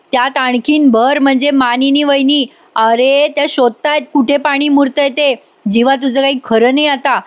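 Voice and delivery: ordinary talk, nothing marked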